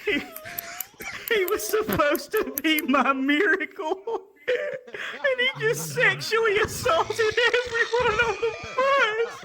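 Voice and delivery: high, wailing mock cry, laughing through it; mock sobbing. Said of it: "She's like".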